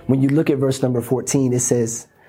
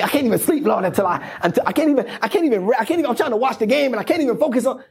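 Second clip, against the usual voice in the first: agitated, restless; 7 semitones higher, faster than usual. Retold or imitated. Restless voice, can't sleep.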